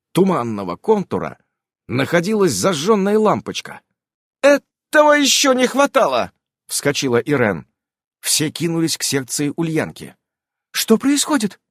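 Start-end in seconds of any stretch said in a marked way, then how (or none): 4.4-6.3 high, louder exclamation of dismay; alarmed exasperation. A narrator's reading. Irene jumps up at the strange glow, alarmed and exasperated. 10.7-11.6 high-pitched worried question; anxious. A narrator's reading. Evelina sees everyone rush to Ulyanka, anxious. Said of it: "с тревогой в голосе спросила".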